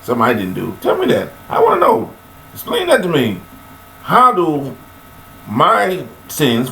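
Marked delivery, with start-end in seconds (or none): 0.8-3.4 high demanding challenge. Real, not acted. Preacher challenges listeners.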